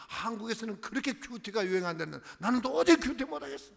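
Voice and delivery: ordinary talk, nothing marked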